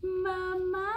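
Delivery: put-on high child's call for mom. A narrator's reading. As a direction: call out like a little kid. Child calls for mother.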